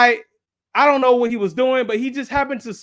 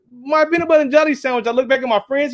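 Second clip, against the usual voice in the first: ordinary delivery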